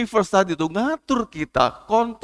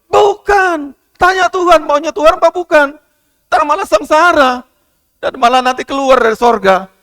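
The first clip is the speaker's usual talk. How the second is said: much higher and louder, shouted exhortation; full-force preaching; 5 semitones higher, 6 dB louder than usual. Acted. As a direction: drive it home to the congregation.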